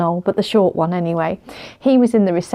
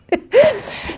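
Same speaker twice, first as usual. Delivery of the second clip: laughing. Real, not acted. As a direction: burst of high laughter. Laughing at being left outside.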